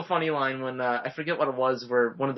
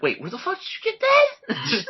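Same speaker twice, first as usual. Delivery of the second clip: surprised; 4 dB louder than usual. Retold or imitated. Han surprised at the lightsaber.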